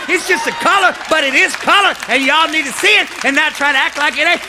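loud, high, driving sermon; full-force preaching. Real, not acted.

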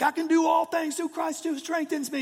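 hammed-up high gym bro quoting scripture; mock lifter boast. Lifter psyching himself up, mock high voice. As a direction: play the lifter straining under the bar. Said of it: "they're like".

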